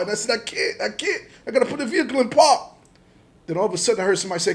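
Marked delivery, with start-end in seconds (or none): 0.4-2.7 high, loud retold pleading; frantic protest. Driver explaining to agents he can't get out, frantic retold shout. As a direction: yell back in a hammed-up panic.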